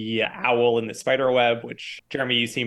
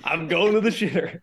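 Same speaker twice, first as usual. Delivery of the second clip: laughing; 5 semitones higher than usual. Pretend. Mocking crude guy voice.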